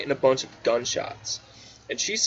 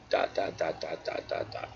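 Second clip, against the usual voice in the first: fading to a whisper; 7 semitones lower, 8 dB quieter than usual. Acted. Fading dots as he dies.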